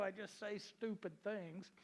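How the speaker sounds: ordinary talk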